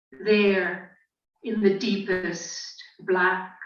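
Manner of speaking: slow, measured poetry reading; poem recitation. Acted. Poet reading a short translated poem to listeners, slow recitation. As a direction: recite it slowly, with long pauses between phrases.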